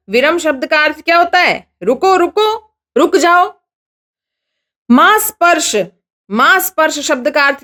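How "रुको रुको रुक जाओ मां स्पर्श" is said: raised, mildly angry stop. A narrator's reading. Wife tells husband to stop, mildly angry. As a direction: scold him to stop. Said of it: "गुस्से से बोलती है".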